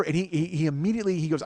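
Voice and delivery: ordinary talk, nothing marked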